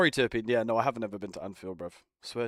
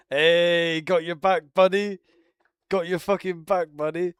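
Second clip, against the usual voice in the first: loud, higher hammed-up pal voice; mock buddy voice; 7 semitones higher, 10 dB louder, slower than usual. Joking to Sosa in a hammed-up loud buddy voice. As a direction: shout it like a cheesy game sidekick.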